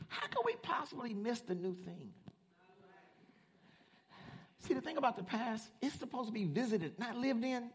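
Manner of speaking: high-pitched, pleading preacher; earnest preaching. Real, not acted. Preacher urging scared church to move forward, earnest high preaching. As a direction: keep the pitch up high but ease off the volume.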